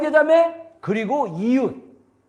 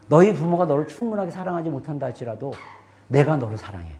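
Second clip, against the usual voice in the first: God's tender voice; 11 semitones lower, 5 dB quieter than usual. Retold or imitated. God speaks to him in prayer.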